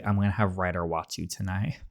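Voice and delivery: ordinary delivery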